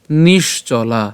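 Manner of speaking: "নিশ্চলা" slowly as nish-cho-laa; careful Sanskrit pronunciation. Shown as a demonstration. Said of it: "নিশ্চলা উচ্চারণটা কেমন?".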